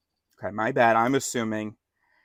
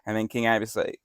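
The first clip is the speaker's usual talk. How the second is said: ordinary talk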